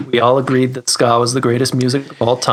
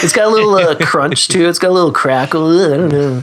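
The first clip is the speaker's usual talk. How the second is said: lightly mocked gear talk, pitched up; mock tone snob; 4 semitones higher, faster than usual. Pedal builder mimics people talking about their tone, lightly mocking. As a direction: poke fun at someone describing guitar tone. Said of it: "I hate it when people talk about their tone".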